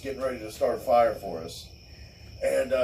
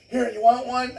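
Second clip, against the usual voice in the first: mock wife; 11 semitones higher, 4 dB louder than usual. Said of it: "She's like".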